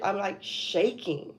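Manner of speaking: usual speaking voice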